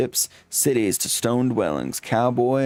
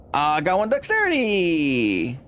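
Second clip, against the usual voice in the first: high, drawn-out triumphant cry; excited cheer; 6 semitones higher, slower than usual. Real, not acted. Player celebrates gaining dexterity, high and slow. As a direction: crow over a tiny win. Excited about stat gain.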